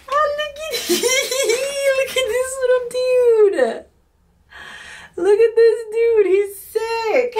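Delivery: real giddy excitement; laughing squeal. Real, not acted. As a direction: squeal and laugh over a cute toy. Unwrapping a crochet figure.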